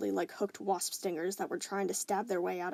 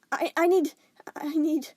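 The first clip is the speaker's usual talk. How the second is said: panicked stammer; 8 semitones higher, 9 dB louder than usual. Acted.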